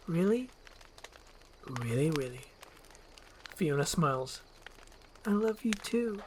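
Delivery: soft, hushed disbelief; lightly voiced, tender; touched Fiona. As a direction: whisper your joy.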